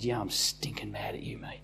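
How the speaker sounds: hushed, intense whisper of anger. Retold or imitated. Pastor tells fallen friend on the phone, whispered fury. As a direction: breathe it out, barely voiced.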